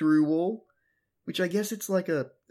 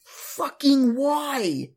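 high, loud, very slow disbelief; exasperated; 10 semitones higher, 4 dB louder, much slower than usual. Real, not acted. Podcaster reacting to Tai's blunder, high loud exasperated. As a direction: drag it out in total disbelief.

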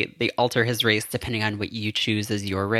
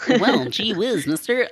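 put-on wholesome 1950s kid; 9 semitones higher, slower than usual. Playful gee-whiz kid bit.

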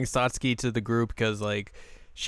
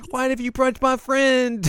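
high, loud, drawn-out protest; mock outraged girl; 5 dB louder, slower than usual. Girl protesting her friend being punched, high mock outrage. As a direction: wail it high in comic outrage. Girl's shocked protest, voiced in recap.

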